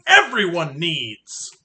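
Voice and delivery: everyone needs yelled loud and slow; yelled slowly. Acted. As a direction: hammer out everyone needs in anger. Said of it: "Sorry, I yelled".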